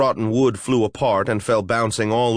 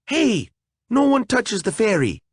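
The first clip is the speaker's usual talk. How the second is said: much higher, outraged fairy squeal; indignant fairy; 12 semitones higher than usual. Acted. A fairy grabbed under an arm, shrill angry protest. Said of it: "angrily kicked her little feet".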